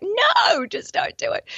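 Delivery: high drawn-out "no", then hushed; mock refusal. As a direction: play an overwhelmed refusal, pitch leaping up. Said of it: "you're like".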